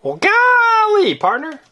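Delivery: high, slow hammed-up cowgirl drawl; cowboy voice. Mom to child as Sheriff Callie, playful cowboy voice. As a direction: play the sheriff with a folksy twang. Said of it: "Sheriff Callie character egg".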